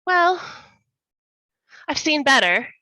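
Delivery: high, loud, dismissive sniff; unimpressed, looking down on it; appraising disdain. Acted. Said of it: "she looks at it appraisingly".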